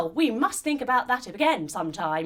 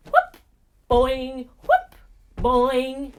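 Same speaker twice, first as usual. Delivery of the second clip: hopping sound effects; 7 dB louder, much slower than usual. Acted. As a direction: bounce out the hop noises.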